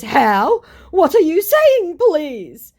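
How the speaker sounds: very high, loud mock dismay; hammed-up bewildered plea; baffled pleading. Scammer told to stop faking profiles, baffled pleading.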